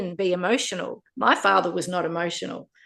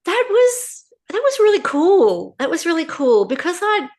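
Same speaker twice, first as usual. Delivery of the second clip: much higher and louder with real delight; thrilled; 9 semitones higher, 6 dB louder than usual. Real, not acted. Asked how meeting her birth father went, gushing, high and loud. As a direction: gush about how good meeting him was.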